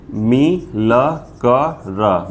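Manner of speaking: मी ल क र spelled out slowly; syllable by syllable. Shown as a demonstration. Teacher spelling मिलकर slowly syllable by syllable. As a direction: break मिलकर into slow syllables. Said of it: "जल्दी पढ़ रहे हैं".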